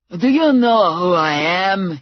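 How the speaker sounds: loud, angry thug demanding recognition; yelling thug. A narrator's reading. Blutovich yelling down at Muner, loud and threatening. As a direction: bark it in his face, nose to nose. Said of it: "he yelled at Muner".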